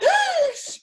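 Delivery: sharp high loud gasp of horror; a man gasping in mock shock; mock horrified gasp. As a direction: gasp as if appalled. Men reacting in horror.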